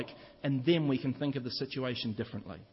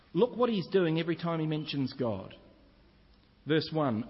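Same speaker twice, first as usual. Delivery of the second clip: ordinary delivery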